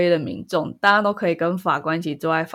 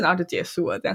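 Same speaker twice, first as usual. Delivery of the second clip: ordinary talk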